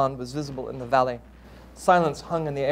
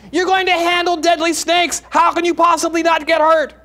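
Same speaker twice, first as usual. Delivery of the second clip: much higher and louder, incredulous; mother pleading with son, shrill with disbelief; alarmed mother; 12 semitones higher, 7 dB louder than usual. Acted. Mother challenging son's snake plan, high and loud.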